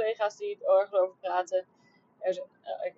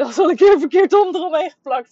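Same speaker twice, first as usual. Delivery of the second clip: laughing; 8 semitones higher, 13 dB louder than usual. Real, not acted. Laughing at own mistake.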